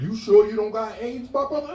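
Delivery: a pushy health ad calling you out; hammed-up concerned commercial; blunt ad voice. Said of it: "was like".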